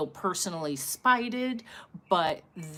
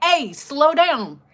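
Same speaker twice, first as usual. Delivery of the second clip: slow, louder warning yell; mock yell; 7 semitones higher, 8 dB louder, slower than usual. The man on the block yelling at the car, slow loud warning. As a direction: call out to a speeding driver. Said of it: "one of the dudes is like".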